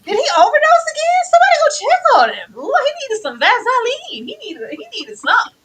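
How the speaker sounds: loud, high, hammed-up panic; mock alarm. Podcaster asking if he overdosed again, mock alarm. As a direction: cry out in exaggerated concern. Acting out alarm at dry lips.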